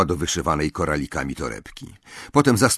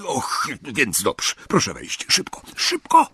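jittery, rushing the words; anxious, hurry them in; nervous hurry; 5 semitones higher than usual. A narrator's reading. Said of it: "zatrzasnął za nimi drzwi". (nerwowy pośpiech)

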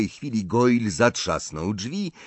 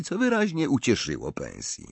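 usual speaking voice

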